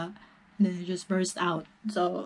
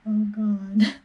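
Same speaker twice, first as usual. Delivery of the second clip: tearful gasp, louder; crying; 2 semitones higher, 5 dB louder than usual. Real, not acted. Vlogger admits to viewers she's crying, tearful. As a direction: voice catching in real tears. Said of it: "when I cry".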